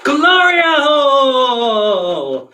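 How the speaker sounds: very slow, high, stretched-out flourish; showy name announcement. Acted. Co-host introducing herself on the show, showy. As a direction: sing out the name, sliding down.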